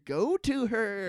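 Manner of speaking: hammed-up dramatic plea. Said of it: "mock dramatic urging".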